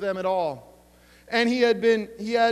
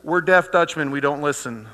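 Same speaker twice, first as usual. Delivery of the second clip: "deaf" hit louder, lower; louder, lower third repeat; 6 semitones lower, 6 dB louder than usual. Shown as a demonstration. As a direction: drive home "deaf Dutchmen" louder. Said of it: "In case you didn't hear that".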